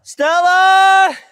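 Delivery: loud, high call across the woods. Real, not acted. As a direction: shout her name to find her far off. Calling lost fox in woods.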